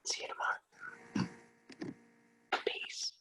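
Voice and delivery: very soft, spaced-out whisper; acted bedtime hush; whispered goodnight. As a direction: whisper a last sign-off with pauses.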